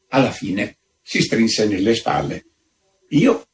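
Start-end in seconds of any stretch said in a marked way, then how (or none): none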